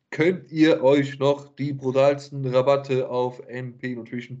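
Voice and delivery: slow, emphatic sponsor ad read. Acted. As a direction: sell the discount code slowly. Reads a sponsor discount ad.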